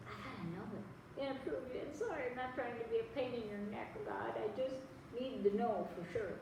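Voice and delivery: softly voiced, apologetic request; sheepish plea. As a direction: ask quietly, as if afraid to bother God.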